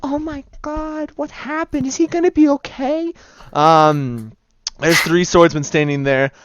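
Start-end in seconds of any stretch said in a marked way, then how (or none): 0.0-3.1 put-on high, soft frightened girl; girl's voice. Bystander girl panicking at the injured merchant, high and soft. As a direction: gasp it out as a worried young woman. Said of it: "the girl goes".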